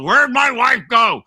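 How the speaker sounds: a fed-up husband asking; mock gruff husband. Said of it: "her husband comes and goes".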